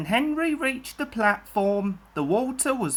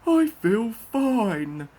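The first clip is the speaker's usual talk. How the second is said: cheerful engine; 3 semitones higher, 4 dB louder, slower than usual. A narrator's reading. Happy Henry after whistling.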